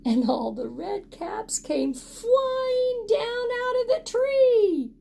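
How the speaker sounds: high, excited rush; excited climax. A narrator's reading. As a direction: let it fly up. Climax of caps falling.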